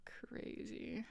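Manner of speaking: a breathless whispered aside. Real, not acted. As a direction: mutter it under your breath in disbelief. Verdict after story.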